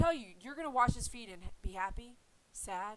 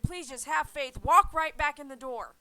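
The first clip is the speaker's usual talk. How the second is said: pleading prayer; 4 semitones higher, 10 dB louder than usual. Retold or imitated. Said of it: "and I said".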